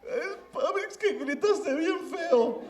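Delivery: put-on very high, slow childish whine with a laugh; a kid admitting the fright; scared little boy. Scared child excusing himself. (niño asustado y quejumbroso)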